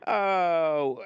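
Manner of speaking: loud burst of laughter; laughing. Real, not acted. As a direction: crack up at yourself. Host laughs at himself.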